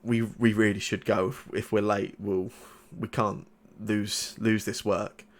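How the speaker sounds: low, soft, hesitant father; lightly voiced father. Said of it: "your father calls out and he says".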